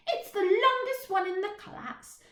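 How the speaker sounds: put-on high, proud little girl boast. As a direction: show off the long ponytail. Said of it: "she added proudly".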